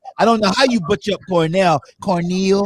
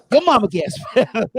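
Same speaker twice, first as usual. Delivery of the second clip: laughing tease; 6 semitones higher, slower than usual. Acted. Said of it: "my mama can spell".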